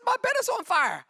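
scared daughter. Retold or imitated. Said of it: "she says".

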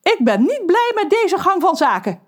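high, indignant objection; protesting. Acted. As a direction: mermaid protests, offended. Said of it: "protesteert Lorelei". (Lorelei protesteert boos)

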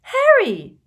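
very high, loud alarmed squeal; horrified high-pitched cry. A narrator's reading. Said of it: "squealed Hermione".

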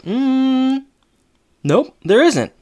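long high hum, then a high, loud nope; mock pondering. Jack dodging Rachel's question about changing her, mock pondering. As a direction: pretend to think, then deny it in a high voice.

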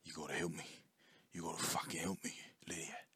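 hushed, imitated Australian accent. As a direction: plead softly in a mock Aussie accent. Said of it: "but it is like".

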